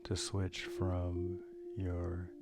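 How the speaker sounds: calm, measured meditation guide with long pauses; unhurried breathing guidance, leaving space after each phrase; guided meditation. Real, not acted. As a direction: lead listeners gently through a breathing meditation.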